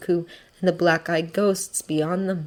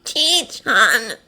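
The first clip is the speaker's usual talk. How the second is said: raspy, slightly raised call; rasping; 4 semitones higher than usual. A narrator's reading. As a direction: rasp out the nickname. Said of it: "Tsubasa rasps".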